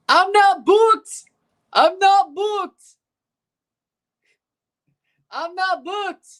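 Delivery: high, slow, cartoonish meme delivery. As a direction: squeak it out like the meme. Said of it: "Whatever meme that is".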